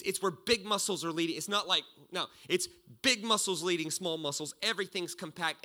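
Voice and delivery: ordinary delivery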